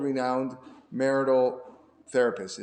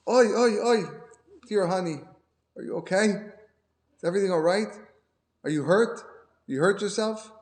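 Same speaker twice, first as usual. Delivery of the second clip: higher, loud, fussing concern; doting new wife; 7 semitones higher, 3 dB louder than usual. Acted. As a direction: fuss over him like a worried new bride. Newlywed wife fusses over fallen husband.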